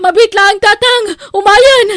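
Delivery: high, loud call; calling back. Acted. Daughter calling back to her father at the door. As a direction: shout to the door.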